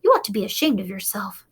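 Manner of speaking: ordinary delivery, nothing marked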